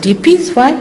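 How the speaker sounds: a sharp high rising "Hippies?" in mock disbelief; incredulous. Retelling to followers her disbelief at the hippies. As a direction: pitch "Hippies?" way up, baffled. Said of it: "I said".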